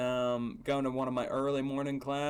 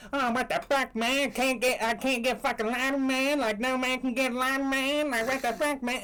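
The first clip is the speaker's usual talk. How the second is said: mock angry rant; 8 semitones higher than usual. Stranger storming off, higher mock rant. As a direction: play the stranger ranting about no lighter. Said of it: "doing that same".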